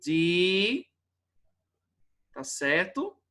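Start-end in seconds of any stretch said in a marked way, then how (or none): 0.0-0.9 "Di" held long as a lone syllable; sílaba isolada. Shown as a demonstration. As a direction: stretch out "Di" by itself. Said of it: "a separação correta da palavrinha". ("Di" alongado, como sílaba separada)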